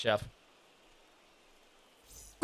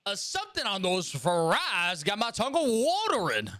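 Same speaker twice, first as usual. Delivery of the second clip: higher, slow Southern drawl; slow Southern twang, pitched up; 6 semitones higher, slower than usual. Shown as a demonstration. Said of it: "brings out a little bit of the, the twang".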